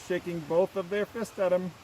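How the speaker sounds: ordinary delivery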